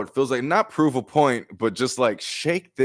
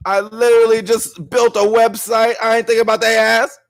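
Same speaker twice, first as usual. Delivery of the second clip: loud, much higher, laughing disbelief; flustered outburst; 10 semitones higher, 6 dB louder than usual. Retold or imitated. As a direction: burst out half-laughing. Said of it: "you're like".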